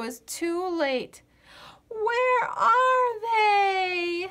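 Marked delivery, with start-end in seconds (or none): 1.9-4.3 long high sobbing shout; drawn-out crying howl; crying wail. Acted. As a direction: wail it out in despair. Potato wails, too late.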